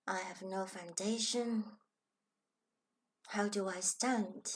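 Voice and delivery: normal talking voice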